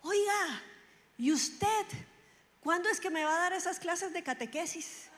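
high-pitched mock demand from the street; mildly yelled, high reproach; retold yell. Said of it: "y me grita".

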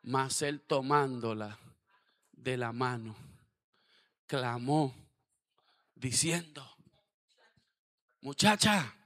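loud, slow and drawn-out scripture reading; fervent preaching read. Shown as a demonstration. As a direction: proclaim each phrase with full force. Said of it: "Aquí viene lo mejor". (lectura ferviente y lenta, en voz alta)

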